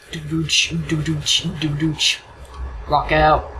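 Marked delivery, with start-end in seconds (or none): 0.1-2.2 beatboxed "doo-doo-ch" rhythm; mouth drum beat. Real, not acted. Player amusing himself as the game loads, mouthed drum beat. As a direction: tap out a little beat with the voice.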